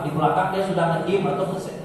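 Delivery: usual speaking voice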